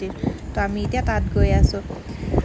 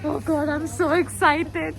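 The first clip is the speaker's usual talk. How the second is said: excited; 5 semitones higher, much slower than usual. Shown as a demonstration. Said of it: "normal acting में बोलो".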